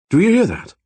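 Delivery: a German scientist urging everyone to listen; German doctor, insistent. A narrator's reading. Repeats, hand to ear.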